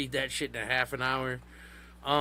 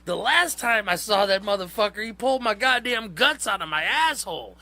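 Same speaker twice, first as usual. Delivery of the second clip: higher, lightly voiced frightened leader; mock horrified; 7 semitones higher, 5 dB louder than usual. Cult leader tells followers why he fears the Hell Priest, higher mock dread. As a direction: recall the torture in a strained, raised pitch. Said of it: "He's like".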